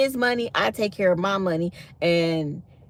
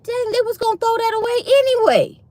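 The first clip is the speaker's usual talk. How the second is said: mock outrage; 13 semitones higher, 4 dB louder than usual. Real, not acted. Mock outrage at oxtail cost.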